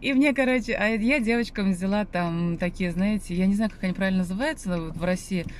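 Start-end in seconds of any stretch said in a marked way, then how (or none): none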